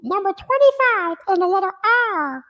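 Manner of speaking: high squeaky little red monster; sing-song like a cheery Muppet; Elmo voice. Pretend. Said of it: "You gotta do your Elmo".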